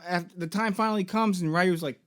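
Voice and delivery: usual speaking voice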